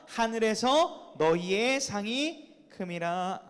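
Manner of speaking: slow, measured, emphatic recitation; scripture reading. Real, not acted. Preacher leading the congregation in Jesus' words, solemn reading. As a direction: read it out deliberately for all to follow.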